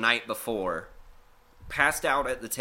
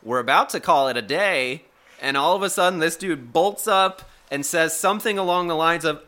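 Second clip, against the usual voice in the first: usual speaking voice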